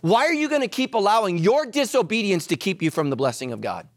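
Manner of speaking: normal talking voice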